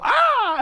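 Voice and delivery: very high, loud yelp; girl hit by a kick, screaming; mock scream. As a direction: squeal like she got kicked. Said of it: "you hear this girl go".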